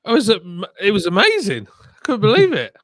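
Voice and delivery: loud, high-pitched disbelief; thrilled and astonished, at high volume; amazed excitement. Real, not acted. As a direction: gush loudly, voice pitched right up. Relives amazement at the review.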